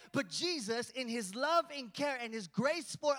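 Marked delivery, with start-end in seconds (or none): none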